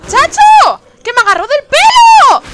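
high, loud shrieking; frantic high shout; panicked yelling. Real, not acted. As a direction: yell as something grabs you.

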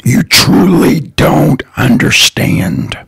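ordinary talk

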